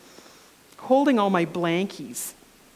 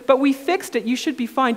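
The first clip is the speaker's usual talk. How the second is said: higher, louder, casual reassurance; breezy reassurance; 5 semitones higher, 5 dB louder than usual. Retold or imitated. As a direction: shrug it off lightly. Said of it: "she says".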